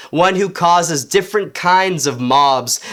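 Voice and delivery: ordinary delivery, nothing marked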